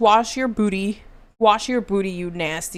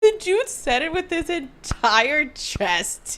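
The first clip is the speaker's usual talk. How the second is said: laughing; 6 semitones higher, slower than usual. Real, not acted.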